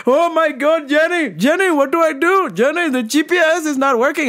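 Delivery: panicked dad. Retold or imitated. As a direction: whine like a lost driver.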